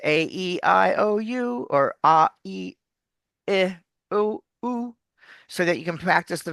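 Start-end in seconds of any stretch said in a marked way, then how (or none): 0.0-4.9 A, E, I, O, U drawn out one by one; vowel exercise. Shown as a demonstration. Coach leading a vowel warm-up, each sound drawn out. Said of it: "vocal exercises".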